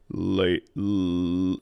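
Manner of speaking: low sung "Lay" pushed off the L; spring off the L of "Lay"; launching from the L. Shown as a demonstration. Vocal coach shows the L as a jumping off point. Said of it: "use the L as the sort of jumping off point".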